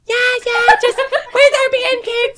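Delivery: high, slow sing-song cover-up through laughter; smooth it over for the server sweetly; fake-cheery friend. Friend telling the server all is fine, high cheery mock voice. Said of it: "Whitney's like".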